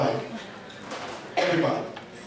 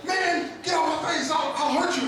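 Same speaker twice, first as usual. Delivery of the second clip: mock angry threat; 7 semitones higher than usual. Imagined person snapping at mom.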